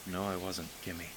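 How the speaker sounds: put-on a boy quietly contradicting his sister; answered softly. Answering his sister softly, barely above a murmur. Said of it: "he answered softly".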